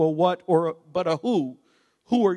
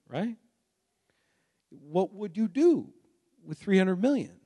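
normal talking voice

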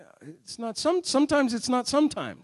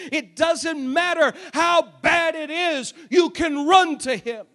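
raised, high-pitched impassioned sermon; full-force preaching; 6 semitones higher, 4 dB louder than usual. Acted. Preacher urging congregation to go to the Lord, full-force preaching. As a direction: preach it out loud with driving emphasis.

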